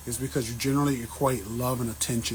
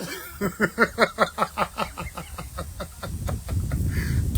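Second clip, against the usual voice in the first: breathy laugh; 6 semitones higher than usual. Real, not acted. Laughing at own joke.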